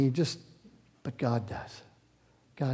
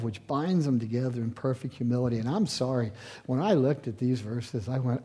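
usual speaking voice

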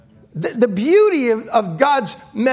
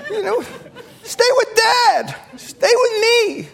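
mock pleading dad; 9 semitones higher, slower than usual. Father joking about his daughters leaving.

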